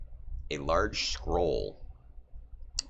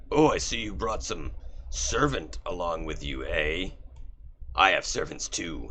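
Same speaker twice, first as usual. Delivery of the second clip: lightly voiced Orcus. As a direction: taunt the rival, smug. Orcus taunts.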